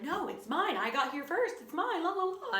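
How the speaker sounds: high, sing-song playground teasing; childish taunt. Retold or imitated. Girl taunting her brother over the chair, sing-song and smug. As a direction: rub it in like a smug little kid.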